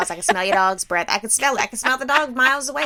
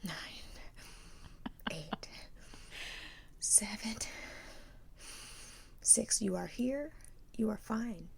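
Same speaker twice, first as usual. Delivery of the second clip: whispered self-calming; 18 dB quieter than usual. Retold or imitated. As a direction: whisper yourself down from panic. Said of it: "close the door ... and just breathe".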